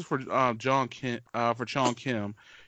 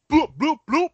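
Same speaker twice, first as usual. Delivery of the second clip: high, loud playful bloops; silly high-pitched bloop-bloop-bloop; comic bloop noises; 13 semitones higher, 8 dB louder than usual. Pretend. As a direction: pop out squeaky, loud bloop sounds. Comic noises closing London impression.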